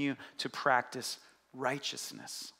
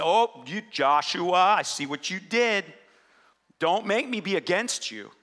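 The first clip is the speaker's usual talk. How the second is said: a mock stern God, louder, scolding; 5 dB louder than usual. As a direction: play an angry God wagging a finger, half in jest. Stern God voiced, scolding Joshua.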